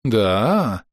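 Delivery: drawn-out, intrigued question; curious trader's drawl; interested drawl. A narrator's reading. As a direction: let the question stretch with interest. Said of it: "заинтересованно протянул вендор".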